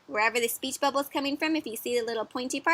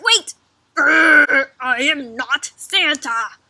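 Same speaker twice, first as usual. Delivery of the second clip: loud growl into a slow denial; angry storybook protest, drawn out; exasperated Bruce; 3 semitones higher, 9 dB louder, much slower than usual. Acted. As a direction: growl it like a fed-up bear. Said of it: "Bruce says".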